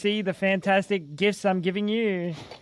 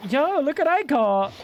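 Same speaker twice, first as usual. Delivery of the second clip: high, bright excited quote; 7 semitones higher, 3 dB louder than usual. Retold or imitated. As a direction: brag about the catch, thrilled. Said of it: "she sounded so happy and excited about it".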